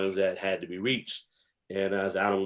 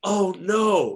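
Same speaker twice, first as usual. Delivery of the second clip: much higher, distressed wail; dismayed oh no; 10 semitones higher, 5 dB louder than usual. Retold or imitated. The neighbor hearing he joined the Five Percent, dismayed. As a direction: imitate her near-tearful dismay. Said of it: "She's like".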